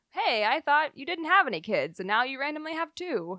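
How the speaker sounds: mock exasperated viewer. Podcast host mocking a show's lazy continuity, incredulous. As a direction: play the annoyed viewer catching a plot hole.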